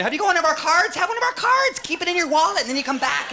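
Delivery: high, eager sing-out sales voice; pushy store clerk. Acted. Store clerk pushing cards on customer, high and pesky. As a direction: pester like a mock cashier.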